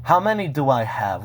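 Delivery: normal talking voice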